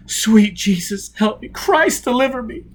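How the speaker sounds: high, loud cries for help; anguished praying. A narrator's reading. Tortured man cries to God under questioning, anguished. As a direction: pray in terror.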